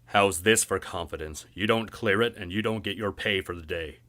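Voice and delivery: loud, hard warning to a worker; threatening boss. Acted. Boss threatening to dock Merritt's pay, loud and hard. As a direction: lean on him with the threat.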